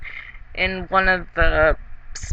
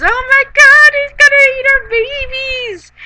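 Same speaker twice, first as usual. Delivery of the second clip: panicked villager shriek. Retold or imitated. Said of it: "sends the whole village into a panic".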